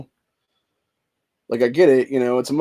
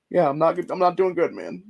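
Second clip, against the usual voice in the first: real emotion breaking through; 5 semitones higher than usual. Real, not acted. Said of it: "I'm fucking emotional right now".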